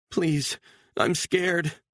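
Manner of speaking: high, begging woman's voice; pleading mother. A narrator's reading. Mother begging her captor to let her go, high and pleading. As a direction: plead for your daughter's sake.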